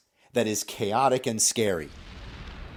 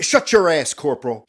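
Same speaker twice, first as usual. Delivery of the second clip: sudden loud, higher snap; angry snap; 3 semitones higher, 7 dB louder than usual. Acted. Captain snapping back at the corporal, angry. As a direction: cut in sharply on the junior man. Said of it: "he snaps back at you".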